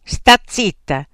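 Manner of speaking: loud, slow command; emphatic, drawn-out rebuke; angry hushing. A narrator's reading. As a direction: shut her up forcefully. Frank silences Beatrice.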